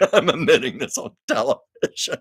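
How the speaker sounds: words bubbling with real laughter; laughing through words. Retold or imitated. Quoting Carol's TV confession while still laughing. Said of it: "And then Carol said".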